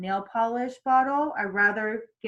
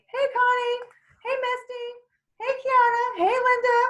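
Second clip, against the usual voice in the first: very high sing-song hellos; cheery roll-call greeting; 14 semitones higher, 4 dB louder than usual. Shown as a demonstration. Coach demonstrating greeting viewers by name, cheery. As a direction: greet each viewer with a chirpy lift.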